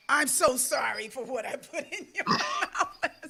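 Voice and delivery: drawn-out apology breaking into laughter; playful apology. Shown as a demonstration. Telling her body sorry for late-night eating, slowly apologetic then laughing.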